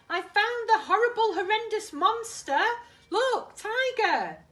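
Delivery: high, loud gleeful call; delighted calling out; triumphant. Acted. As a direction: show off the find. Kipper triumphant finds monster.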